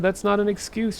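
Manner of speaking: high-pitched angry retort, not full scream; acted scream. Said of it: "she screamed".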